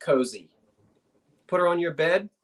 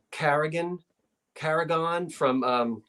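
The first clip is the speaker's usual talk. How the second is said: ordinary talk, nothing marked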